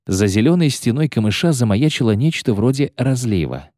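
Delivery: ordinary delivery, nothing marked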